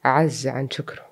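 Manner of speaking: usual speaking voice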